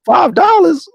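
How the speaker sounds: high incredulous repeat; high outraged question; outraged disbelief. Retold or imitated. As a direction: go way up, can't believe it.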